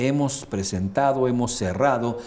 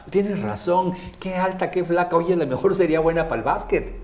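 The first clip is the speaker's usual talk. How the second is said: higher, quick light agreement; subtle reframe; 6 semitones higher than usual. Retold or imitated. Coach reframing a friend's jeer, quick high subtle agreement. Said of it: "Lo hago muy sutil".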